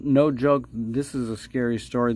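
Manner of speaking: ordinary talk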